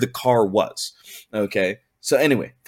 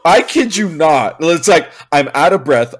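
usual speaking voice

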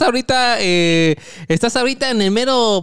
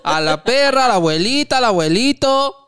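loud Colombian vendor pitch; 5 semitones higher, 4 dB louder than usual. Pretend. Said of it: "fue acento de siete mesino".

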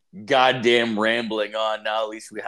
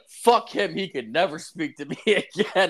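petty mock rage; 6 semitones higher, 3 dB louder than usual. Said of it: "I was like".

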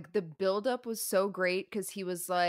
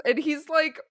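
ordinary delivery

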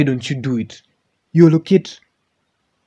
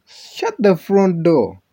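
exasperated shout; 7 semitones higher, 7 dB louder, slower than usual. Real, not acted. Exasperated exclamation, loud and slow.

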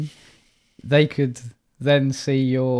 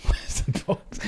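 whispered echo; 7 dB quieter, much slower than usual. Retold or imitated.

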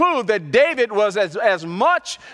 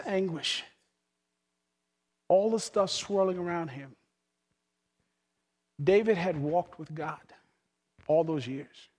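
quiet reflection; 2 semitones lower, 3 dB quieter than usual. Acted. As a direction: draw them in softly. Softer reflective sermon.

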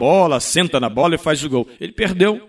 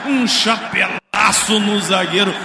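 screaming goal call, stretched high; explosive goal commentary; 10 semitones higher, 4 dB louder, slower than usual. Real, not acted. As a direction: roar the goal like a radio narrator. Radio goal call, shouted.

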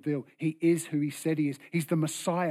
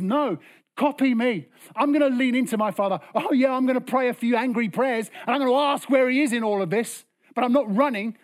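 emphatic insistence; 6 semitones higher, 5 dB louder than usual. Acted. Acting Jesus's defiant reply.

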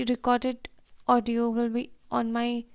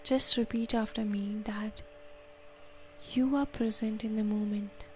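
soft, unhurried counting and calming guidance; guided meditation; 7 dB quieter than usual. Acted. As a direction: talk them gently through each breath. Leads a breathing meditation.

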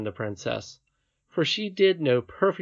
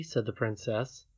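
usual speaking voice

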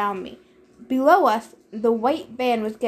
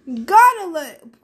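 loud, drawn-out cry; yell; 6 semitones higher, 7 dB louder, slower than usual. A narrator's reading. Blackjack the horse shouts out in alarm, yelling. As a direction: yell it out, pitch thrown up. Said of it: "Blackjack yelled".

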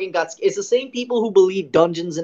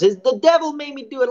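high, whiny excuse voice; mocking addict voice; 8 semitones higher, 4 dB louder than usual. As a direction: mimic a junkie blaming the devil. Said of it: "at a certain moment he goes".

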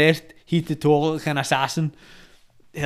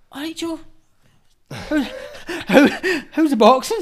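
cheery greeting; 12 semitones higher, 4 dB louder than usual. Retold or imitated. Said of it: "And he's like".

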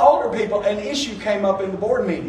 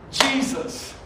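normal talking voice

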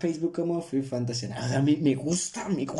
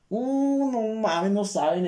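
excited; 9 semitones higher, slower than usual. Real, not acted. Dreaming of being Spider-Man.

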